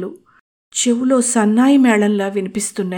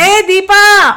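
much higher, louder shout; calling out; 12 semitones higher, 8 dB louder, much slower than usual. Acted. Anu shouting up the stairs for Deepa, loud call. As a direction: call up from downstairs. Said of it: "కేక వేస్తోంది".

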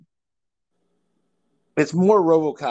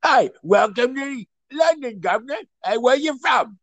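high, exaggerated Cockney cab driver; thick hammed-up Cockney greeting; severe Cockney; 7 semitones higher than usual. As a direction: do an over-the-top chirpy London cabbie in Cockney. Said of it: "he was a severe Cockney".

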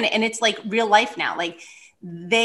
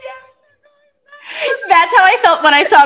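higher, laughing and crying through it; 10 semitones higher, 8 dB louder, much slower than usual. Real, not acted. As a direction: overcome with relief. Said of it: "laugh crying along".

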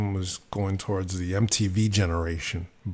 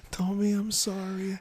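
put-on man doing a high, soft old woman's voice; sweet little old lady apologizing; 11 semitones higher, 4 dB quieter than usual. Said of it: "A soft little old lady's voice".

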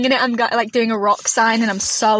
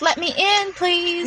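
pleading; 6 semitones higher, 3 dB quieter, much slower than usual. Retold or imitated. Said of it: "where she goes".

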